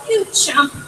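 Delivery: ordinary delivery, nothing marked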